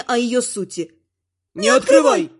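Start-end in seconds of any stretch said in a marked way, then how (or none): 1.5-2.3 sudden urgent shout; alarmed cry. A narrator's reading. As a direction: yell a warning to stop someone. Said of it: "вскричали властелин и властительница".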